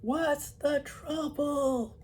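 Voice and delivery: high, very slow bellow; full-blooded shout. Acted. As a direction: shout it at a deaf man. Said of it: "full-blooded shout".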